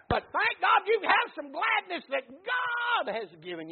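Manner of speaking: loud preaching climbing in pitch to a shout; full-force preaching. Real, not acted. Preacher assures congregation of lasting gladness, full-force preaching. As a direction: pound out each loss, building to a drawn-out peak.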